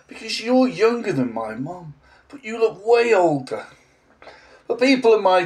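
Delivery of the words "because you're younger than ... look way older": higher-pitched imitation of a child; lightly voiced little pupil explaining. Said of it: "a child said to me".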